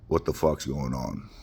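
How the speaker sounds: ordinary talk, nothing marked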